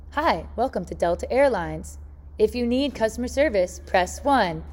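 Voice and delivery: recorded customer-service menu voice; automated phone greeting. Retold or imitated. Airline's phone system greets a caller, automated menu voice. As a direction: recite it like an automated phone line. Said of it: "I gotta call Delta Airlines".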